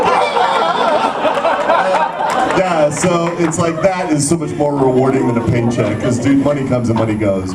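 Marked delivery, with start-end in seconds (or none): 0.0-1.2 high, hammed-up squeal; drawn-out mock scream of joy; high fangirl shriek, acted out; excited fan scream. Both freaking out, retold.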